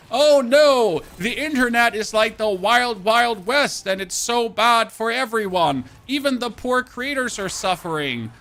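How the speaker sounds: high, loud, hammed-up alarm, slowing down; mocking fake concern; mock media panic. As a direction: ham up a panicked news anchor. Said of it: "the traditional media being like".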